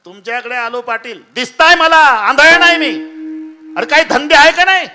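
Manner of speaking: loud, high scolding rant; angry rich man. Retold or imitated. Rich landlord berating visiting pilgrims, loud angry rant. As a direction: rich man snapping at the beggars.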